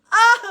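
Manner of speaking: short high yelp of protest; mock scream. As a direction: yelp as if grabbed. Child screams being dragged.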